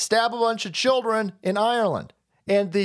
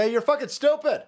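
higher, blunt mock insult; 6 semitones higher than usual. Imitating the president insulting a reporter, bluntly. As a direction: throw the insult straight out, pitched up. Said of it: "not in a dismissive way".

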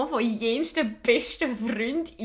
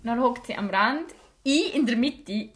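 normal talking voice

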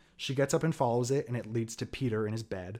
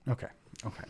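ordinary talk

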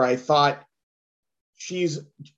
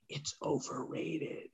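slow mock-confidential whisper; whispered secret; 20 dB quieter, slower than usual. Comic reveals the film's supposed secret, whispered. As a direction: whisper it slowly, as if letting out a secret.

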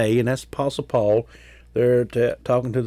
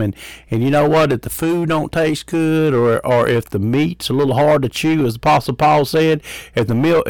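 rising preacher's fervor; preaching; 3 semitones higher, 4 dB louder than usual. Acted. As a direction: preach it with building force. Preaches to listeners.

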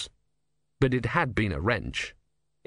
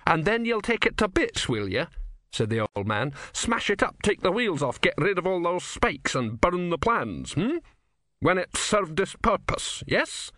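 put-on higher, needling old man voice; probing old philosopher; 7 semitones higher than usual. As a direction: press him with sly questions. Didactylos presses Ern.

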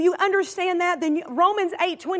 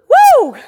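loud, high joyful whoop; excited whoop; 11 semitones higher, 13 dB louder than usual. Real, not acted. Pastor whooping to the congregation, loud and high. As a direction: shout for joy at the victory. Preacher exults after scripture.

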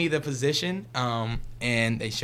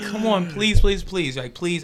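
imitating a begging sister, higher and louder; pleading; 6 semitones higher, 8 dB louder than usual. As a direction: beg with mock desperation, easing off at the end. Sister begging him to coach.

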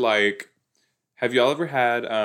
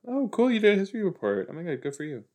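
high, fast mock-impressed gushing; sarcastic praise; 7 semitones higher, much faster than usual. Mocking the character's history report with sarcastic praise. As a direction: fake enthusiasm, dripping with sarcasm.

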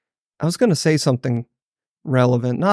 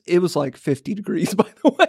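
ordinary delivery

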